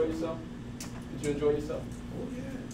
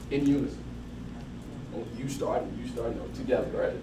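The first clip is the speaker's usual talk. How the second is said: ordinary talk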